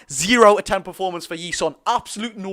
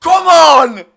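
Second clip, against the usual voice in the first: loud celebratory shout; 8 semitones higher, 10 dB louder than usual. Real, not acted. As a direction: bellow it out, louder and higher. Fan celebrates penalty.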